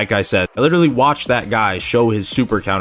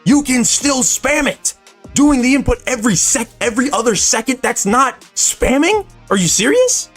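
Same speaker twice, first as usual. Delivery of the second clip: wild unhinged ranting; 9 semitones higher than usual. Real, not acted. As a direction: rant in disbelief, voice pitched up. Exasperated rant about spamming.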